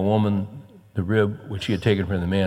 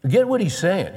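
ordinary talk

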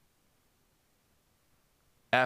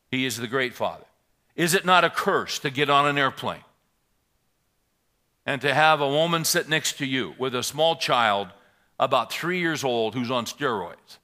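loud, raised pulpit preaching voice; preaching at full force; 6 semitones higher, 14 dB louder than usual. Acted. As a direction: drive the scripture home to the congregation. Reads and preaches on Hebrews.

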